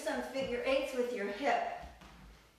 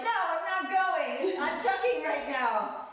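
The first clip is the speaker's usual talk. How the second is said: higher, louder, slow mock refusal; stubborn stomach voice; 7 semitones higher, 6 dB louder, slower than usual. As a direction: play the belly refusing to let go. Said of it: "It just is like".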